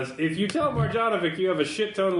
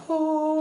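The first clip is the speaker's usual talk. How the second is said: high sustained sung tone; sound effect on request; 13 semitones higher than usual. Pretend. Asked if the Rolodex has a sound, high held note. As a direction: hold one high, steady note. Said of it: "Is there, like, a sound".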